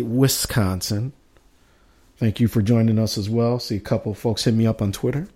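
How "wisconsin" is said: "Wisconsin" drawn out softly as WIS-consin; corrected local pronunciation. Shown as a demonstration. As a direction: say "Wisconsin" slowly and softly, with a careful short-i WIS. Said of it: "Twitter bud Shannon to say".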